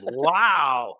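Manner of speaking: high, loud drawn-out mock wonder; mock amazement. Speaker ribbing conference crowd's predictable answer, mock amazement. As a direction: feign being hugely impressed.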